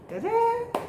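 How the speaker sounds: high bright ta-da flourish. Real, not acted. Vlogger unveiling her wedding topic, high playful ta-da. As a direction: present it like a magic reveal.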